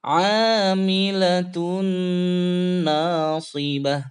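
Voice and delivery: slow chanted Amilatun with nasal hum; slow tajwid chant; Quran recitation. Shown as a demonstration. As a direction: hum the nun for two beats. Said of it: "Sekali lagi".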